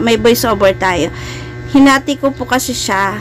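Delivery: ordinary talk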